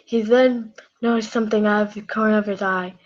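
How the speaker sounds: usual speaking voice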